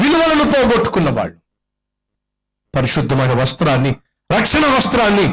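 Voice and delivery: high, ringing preacher's cry building to a peak; fiery preaching. Acted. Preacher warning the sinful congregation, fiery high preaching. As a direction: preach it out to the whole hall.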